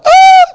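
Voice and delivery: sudden very high, loud shriek; mock terrified scream. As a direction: scream like you saw a ghost. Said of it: "and they're like".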